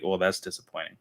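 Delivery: quiet, let-down murmur; mild deflated letdown; flat disappointment. Retold or imitated. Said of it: "I was like".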